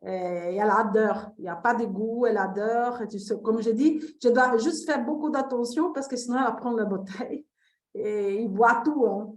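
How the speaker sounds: Brazilian accent in French; French spoken with a Brazilian accent; foreign accent in french. Real, not acted. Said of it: "mon français, c'est pas très bien". (accent brésilien en français)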